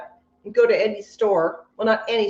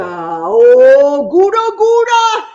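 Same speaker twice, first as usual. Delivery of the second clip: loud, slow swoop up high; gleeful hooting call; 5 semitones higher, 11 dB louder, much slower than usual. Pretend. Host revels in Gouda, loud gleeful call. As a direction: swell the oh, then sing out high. Leader launches Gouda laughter.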